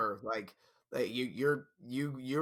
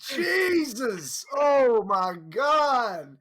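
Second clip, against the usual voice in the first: high, loud, drawn-out exclamation; slow it down and yell in disbelief; real howling shock; stunned shout; 9 semitones higher, 7 dB louder, much slower than usual. Real, not acted. Reacting to brutal texts.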